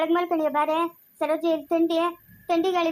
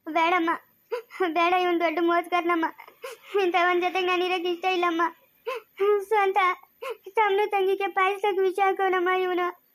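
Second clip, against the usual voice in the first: crying accusation; 2 semitones higher than usual. Acted. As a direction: sob out the accusation. Wife weeps accusing husband.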